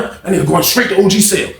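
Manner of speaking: ordinary talk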